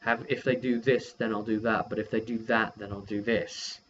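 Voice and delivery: normal talking voice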